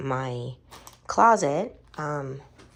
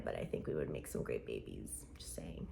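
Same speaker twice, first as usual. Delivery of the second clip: sly quiet aside; 12 dB quieter than usual. Real, not acted. Cheeky aside about a crush.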